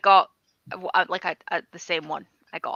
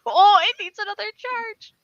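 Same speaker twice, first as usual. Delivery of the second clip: high, loud mock dismay; mock exasperation; 12 semitones higher, 8 dB louder than usual. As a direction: squeal it in fake despair. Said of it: "I'm like".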